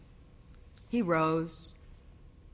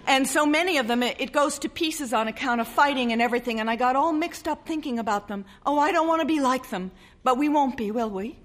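anxious bride; 6 semitones higher, faster than usual. A narrator's reading. Wife's rushed anxious outpouring.

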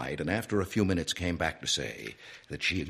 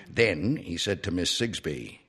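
plain reading voice

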